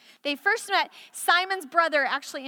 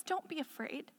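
soft reassurance; 14 dB quieter than usual. Retold or imitated. Jesus' words softly retold.

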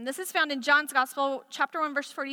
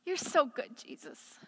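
hushed prayer; 8 dB quieter than usual. Real, not acted. Quiet prayerful aside.

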